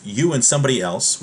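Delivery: ordinary delivery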